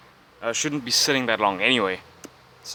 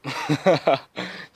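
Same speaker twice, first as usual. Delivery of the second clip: amused chuckle mid-thought; real chuckle; 2 semitones higher than usual. Real, not acted. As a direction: crack up briefly. Cracks up mid-explanation.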